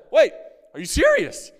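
very high, loud "are you serious"; shocked disbelief. Retold or imitated. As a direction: gasp it out in shock. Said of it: "And I said".

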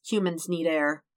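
ordinary delivery

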